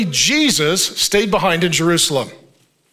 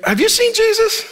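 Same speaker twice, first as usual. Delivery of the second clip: high, laughing mock-panicked mom; comic high-pitched worried mom; frantic mother; 13 semitones higher than usual. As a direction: squeal it like a mother who just noticed. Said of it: "she's like".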